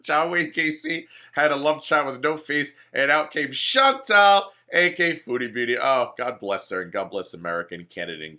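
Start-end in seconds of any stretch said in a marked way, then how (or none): 0.0-5.8 laughing. Real, not acted. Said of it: "laughing joke".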